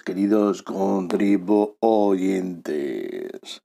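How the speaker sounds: slow, hoarse hangover croak; cavernous voice from a hangover. Real, not acted. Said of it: "Si notáis una voz cavernosa".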